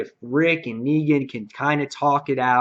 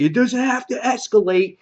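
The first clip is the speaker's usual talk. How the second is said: high, raised mock plea from a sentry; called-out appeal; 10 semitones higher, 4 dB louder than usual. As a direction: imitate a guard calling down to attackers. Acted guard calling down in retelling.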